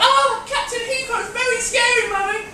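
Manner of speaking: put-on high, slow frightened child; scared child voice. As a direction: squeak like a frightened little kid. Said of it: "The children are like".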